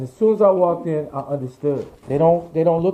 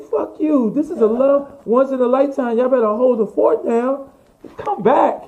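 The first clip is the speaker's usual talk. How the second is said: high, emphatic mock indignation; re-enacted excited defiance; defiant retort; 9 semitones higher, 4 dB louder than usual. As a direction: push back at the team in a raised pitch. Acted argument with team over returning.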